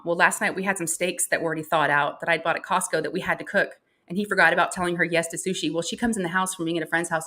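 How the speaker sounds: ordinary talk, nothing marked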